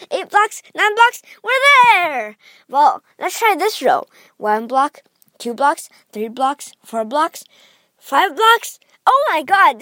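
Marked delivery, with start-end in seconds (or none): 0.1-2.4 rising to a triumphant cry; excited counting. Pretend. Counting blocks to the goal. 8.0-9.8 surprised. Pretend. Finding a short route.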